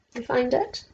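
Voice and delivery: plain reading voice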